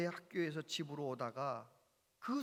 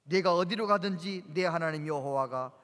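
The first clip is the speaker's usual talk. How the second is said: scripture reading; 6 dB louder than usual. Acted. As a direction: proclaim the verse to the congregation. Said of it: "한번 읽어볼까요? 시작".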